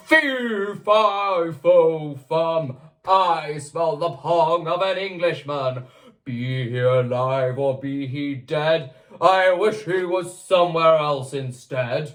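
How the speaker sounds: booming giant chant; giant roar. Acted. Giant roars at the smell of Jack. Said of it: "roared the giant".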